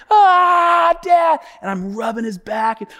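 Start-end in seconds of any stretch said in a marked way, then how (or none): 0.1-1.4 higher, louder mock wail; mimicked child crying. Boy picked up by his dad, imitated crying. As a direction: bawl like a hurt little boy. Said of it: "And he's crying".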